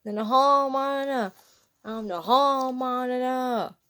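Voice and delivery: loud, high, joyful chant; shouted. A narrator's reading. SpongeBob shouting around the classroom, loud excited chant. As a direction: yell it while bouncing off the walls. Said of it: "all while he shouted".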